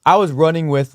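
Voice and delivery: ordinary talk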